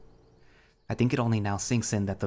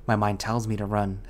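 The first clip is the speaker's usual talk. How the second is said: ordinary delivery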